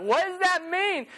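high, puzzled question; confused girls asking. Retold or imitated. Girls asking what mom's greeting means, high and puzzled. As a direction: pitch it up in confusion. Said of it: "And they're like".

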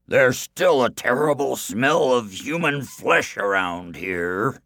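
slow, loud suspicious giant. Acted. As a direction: sniff it out suspiciously. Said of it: "he said suspiciously".

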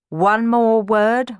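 forceful, measured threat; warning. A narrator's reading. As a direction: warn them, weighting every word. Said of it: "warned Ramiro".

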